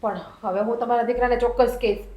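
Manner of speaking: ordinary delivery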